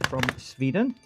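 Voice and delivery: mock Swedish accent. Streamer introduces the leader from Sweden, dodgy Swedish accent. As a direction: put on a rough Swedish accent. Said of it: "don't know if that's a Swedish accent".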